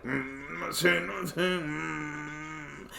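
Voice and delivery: soft high closed-mouth hum; stiff, silent friend. Retold or imitated. Scared friend facing cops, stiff muffled hum. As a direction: hum it tightly as if frozen, barely audible. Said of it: "was not saying anything, he just standing there stiff".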